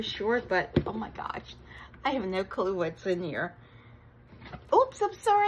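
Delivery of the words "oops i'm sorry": high, loud startled apology; startled oops. Real, not acted. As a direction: yelp it out as you catch yourself. Nearly flashed the camera.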